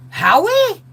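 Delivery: high, loud disbelieving Howie?; surprised. Retold or imitated. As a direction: gasp out his name in shock. Surprised at his call.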